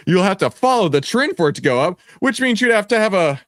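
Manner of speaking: rising high and loud into a shout; excited hype. Real, not acted. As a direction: build up to a cheer. Building to bingo call.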